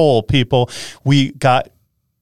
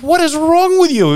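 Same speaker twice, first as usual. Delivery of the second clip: exasperated retort; 3 dB louder than usual. Retold or imitated. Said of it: "I'm like".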